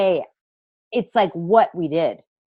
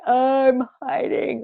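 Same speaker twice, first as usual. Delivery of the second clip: mock crying; 5 semitones higher, slower than usual. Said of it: "like crying through tears".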